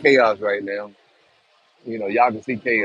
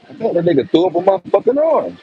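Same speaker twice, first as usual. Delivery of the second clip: real shock; 8 semitones higher, 6 dB louder than usual. Real, not acted. Reacting to the mess.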